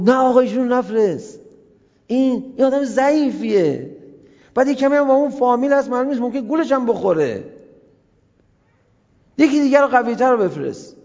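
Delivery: high, loud, fast objection; urgent protest. Retold or imitated. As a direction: plead fast and insistently. (اعتراض تند و پرشور)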